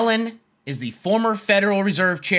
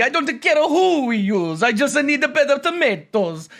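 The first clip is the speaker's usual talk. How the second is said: high, hammed-up Italian accent; agitated cartoon Italian, pitched way up; 7 semitones higher than usual. Italy complaining about the price of tomatoes, Italian accent. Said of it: "what do you think, uh, Italy?".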